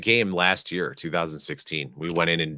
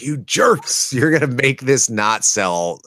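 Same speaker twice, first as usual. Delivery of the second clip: higher-pitched playful indignation; mock outrage; 6 semitones higher, slower than usual. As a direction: scold them in mock exasperation. Retelling own annoyance at the sketch.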